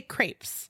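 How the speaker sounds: crepes said as "krapes"; American pronunciation. Shown as a demonstration. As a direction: say crepes with a long a, like "krayps". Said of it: "We say them differently".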